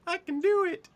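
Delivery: very high, squeaky pep; mock self-cheering. Game master answers encouragement, mock high cheer. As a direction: squeal it back like a tiny cheerleader.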